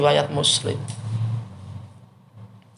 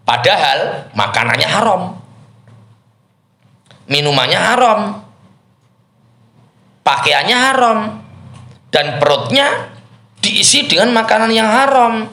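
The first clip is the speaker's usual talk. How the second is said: rising preacher's rebuke; 10 semitones higher than usual. Acted. Preacher rebuking the traveler, voice climbing with each haram. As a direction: build the indignation line by line, hammering haram. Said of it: "preacher builds the condemnation".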